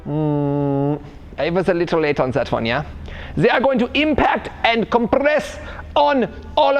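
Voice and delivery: hammed-up German fitness coach explaining; the v for w, the j sounds, stern but cheery; fake German accent. Coach explaining warm-up theory to viewers, fake German accent.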